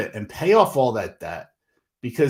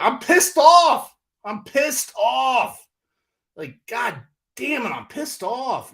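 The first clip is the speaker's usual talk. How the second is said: drawn-out, much higher and louder, fed-up venting; real anger; 13 semitones higher, 6 dB louder, slower than usual. Real, not acted. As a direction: let the frustration boil over slowly. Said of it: "I just get pissed off".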